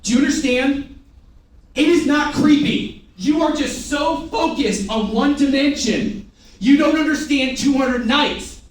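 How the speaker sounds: loud, high, slow insistence; fired-up push. Real, not acted. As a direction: hammer each word to the crowd. Trainer fires up the room.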